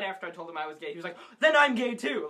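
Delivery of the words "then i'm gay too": high, loud mock blurt; blurted confession. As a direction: imitate a panicked, eager outburst. Retold frantic blurted confession.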